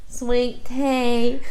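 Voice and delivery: a drawled Southern Kentucky "Sweet tea"; imitating a Kentucky local's Southern accent. Recalling a Kentucky trip to her wife, Kentucky accent. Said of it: "that's the accent".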